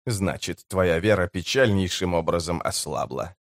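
low, soft understated mockery; mocking. A narrator's reading. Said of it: "насмешливо бросил работорговец". (тихо, сдержанно насмехаясь)

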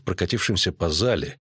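ordinary delivery, nothing marked

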